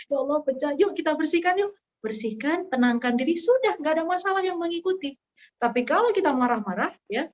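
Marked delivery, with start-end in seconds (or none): 0.1-5.2 calm response. Acted. Calmly cleaning broken plate.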